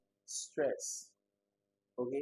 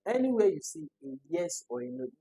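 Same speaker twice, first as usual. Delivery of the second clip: ordinary talk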